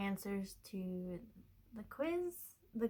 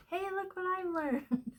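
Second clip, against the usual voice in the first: proud excited; 9 semitones higher, 3 dB louder than usual. Retold or imitated. Showing off her new chart.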